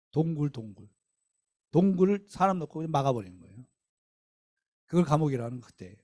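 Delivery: normal talking voice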